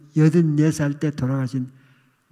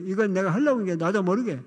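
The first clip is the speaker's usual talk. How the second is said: ordinary delivery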